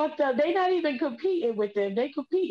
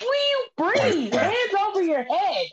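urgent; 8 semitones higher than usual. Real, not acted. Friend choking, urgent instructions.

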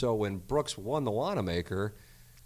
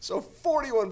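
high, giggling through the words; can't stop laughing; slower than usual. Real, not acted. Host keeps laughing at himself.

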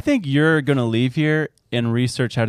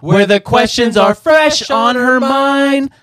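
announcer voice; 8 semitones higher, 4 dB louder, slower than usual. Acted. Segment intro continues.

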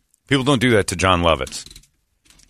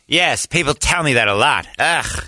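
higher, put-upon celebrity impression; Jon Lovitz voice; 5 semitones higher than usual. Lovitz answers an apologetic lady, pompous put-upon impression. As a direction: imitate a star wearily burdened by fame. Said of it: "In that voice".

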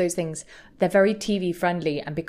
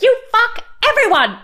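high shrieking yell, much louder; screaming; 9 dB louder than usual. Retold or imitated. Bethany screaming at Luann, a loud high shriek. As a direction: scream the accusation right at her. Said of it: "screaming at Luann".

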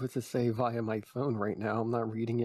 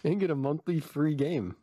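mock sponsor pitch; ad read; 5 semitones higher than usual.

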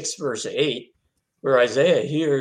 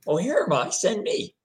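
timid, higher-pitched, tentative offer; volunteer timidly, pitch lifting on "send me"; hesitant, rising voice; 8 semitones higher than usual. Retold or imitated. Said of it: "he kind of timidly says".

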